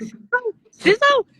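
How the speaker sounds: very high, squeaky questioning disbelief; high-pitched mock-astonished question; Kyle's incredulous echo. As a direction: mimic a baffled friend, pitch shooting way up. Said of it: "And Kyle was like".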